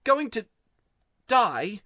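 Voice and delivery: put-on boy's voice rising, close to tears; holding back tears. A narrator's reading. Diggory tells Polly of his ill mother, near tears. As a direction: push on, voice catching before die. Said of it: "trying to keep back your tears".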